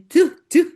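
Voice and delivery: loud spitting sound; mock shaman spitting. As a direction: spit it out like a shaman. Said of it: "dukun tuh sering ludah gini".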